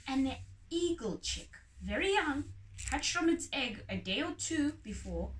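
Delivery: ordinary delivery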